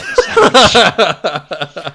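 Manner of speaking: loud, laughing deflection. Real, not acted. Teased about sandwich.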